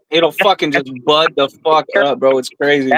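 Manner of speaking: normal talking voice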